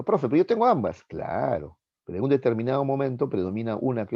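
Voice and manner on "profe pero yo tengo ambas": higher, louder eager student voice; student's objection. Pretend. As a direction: play a pupil raising a doubt. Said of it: "se estarán preguntando".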